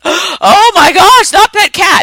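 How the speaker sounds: very high, loud, drawn-out slow wail; mock hysterical crying. Friend collapsing in tears over the cat, hammed-up wail. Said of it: "they're crying".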